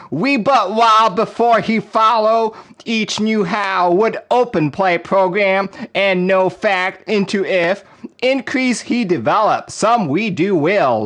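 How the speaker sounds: high, loud Southern spoken-word recital; dramatic Southern accent. Shown as a demonstration. Streamer doing requested spoken word for chat, dramatic Southern accent. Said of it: "give us a Southern accent spoken word".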